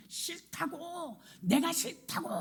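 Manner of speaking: put-on high, whiny child's voice drawn out; sulky child's refusal. A spoiled child refusing the pastor, in a high whiny voice. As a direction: pout it like a spoiled kid. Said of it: "막 이러더래요".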